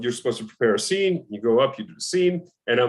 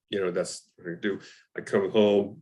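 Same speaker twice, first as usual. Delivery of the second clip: ordinary delivery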